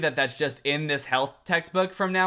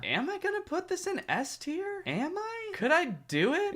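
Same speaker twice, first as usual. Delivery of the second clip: very high, hushed pretend dithering; mock suspense; 13 semitones higher, 5 dB quieter than usual. Vlogger teasing whether this photo gets S tier, mock suspense. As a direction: tease the big decision in a squeaky-high, softer voice. Mock agonizing over top rank.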